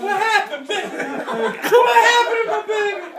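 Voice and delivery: high, slow, doting nanny voice with a laugh; nanny cooing over her crying baby, high and drawn out; Ruby fussing over me. Retold or imitated. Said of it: "And Ruby said".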